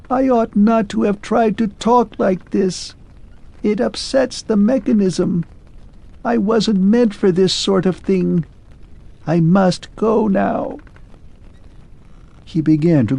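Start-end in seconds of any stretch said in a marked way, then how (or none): 0.1-10.8 much higher thin machine voice; a mechanism straining to speak; shrill fading voice. Acted. Clockwork man excuses himself to Arthur, thin and shrill.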